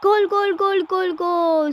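high, slow, yelled goal call; screaming football commentator. Acted. Goal scored in game.

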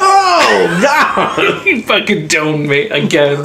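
high laughing cry of disbelief. Real, not acted. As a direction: laugh through the outrage. Gets killed in game again.

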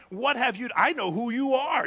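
half-shouted demonic outburst; demon's outcry. Acted. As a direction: cry out as the possessed man. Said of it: "So they shout out".

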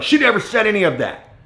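high-pitched mock protest; indignant denial. Supervisor denying what his colleague said, high and indignant. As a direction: imitate a defensive supervisor butting in.

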